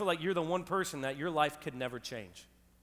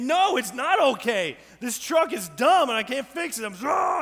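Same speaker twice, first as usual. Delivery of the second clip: loud, high frustrated outburst; full-voiced angry rant at a stubborn truck; angry yelling; 6 semitones higher, 9 dB louder than usual. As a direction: yell it in exasperation, building to a roar. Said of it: "I am yelling. I'm screaming".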